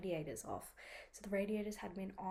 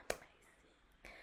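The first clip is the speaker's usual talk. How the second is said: sarcastic whisper; 12 dB quieter, slower than usual. Retold or imitated. Bus cancelled, sarcastic reaction.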